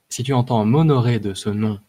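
usual speaking voice

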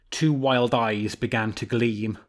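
plain reading voice

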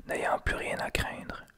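ordinary delivery, nothing marked

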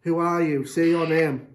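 loud demanding. Real, not acted. Provoking the spirit aloud.

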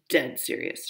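hushed, grave whisper. Acted. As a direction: say it like a secret. Sister answers in secrecy.

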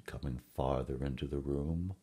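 plain reading voice

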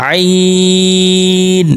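আইন drawn out long and squeezed from the middle of the throat; pressed ain. Shown as a demonstration. Teacher shows students the pressed throat আইন, long and held. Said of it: "একটু চেপে উচ্চারণ করতে হবে".

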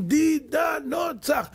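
thousands roaring 'דידנו צח', high and very slow; crowd shouting cheer. Retold or imitated. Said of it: "הם עמדו בצד, רבותיי, אלפים של אנשים".

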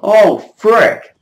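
loud, high, rapid panic shout; real high-pitched alarm, full volume; panicked yell. Real, not acted. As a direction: yell it in a sudden scramble. Sudden forced escape in game.

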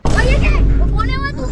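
high, loud startled yell; startled shout. Real, not acted. Friend in the cage sees the animal put inside and shouts in alarm.

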